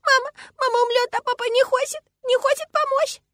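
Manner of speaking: very high, loud tearful wail; child sobbing. A narrator's reading. Girl begging father to help mother, sobbing. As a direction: wail it like an inconsolable little girl. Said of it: "всхлипывает она между слезами".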